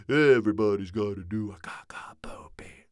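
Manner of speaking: secret whisper; whispered tease. Acted. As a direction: whisper the silly line. Joey teasing.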